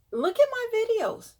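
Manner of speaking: outraged high call; exasperated. Real, not acted. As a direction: cry it out in disbelief. Exasperated at the numbers.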